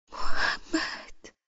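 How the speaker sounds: very slow, breathless, barely voiced name; trembling faint call. Wife calling her husband's name, faint and trembling. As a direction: let the name fall out, weak and shaking. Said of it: "با صدایی لرزان و ضعیف".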